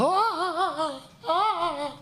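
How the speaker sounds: high, breathy mock panic laughs; panicked elf cries. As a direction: squeal like flustered girls. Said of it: "these girls are like".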